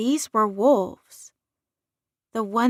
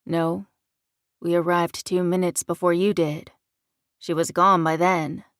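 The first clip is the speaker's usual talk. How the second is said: lightly voiced southern man, lower; a hint of a southern accent, pitched lower; 4 semitones lower than usual. A narrator's reading. Man answers the daughter's demand, light southern accent. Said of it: "The man who had a southern accent responded".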